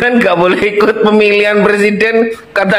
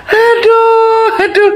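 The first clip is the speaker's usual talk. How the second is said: laughing through pain; slower than usual. Real, not acted. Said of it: "Aku ini sampai ketawa, perutku sakit".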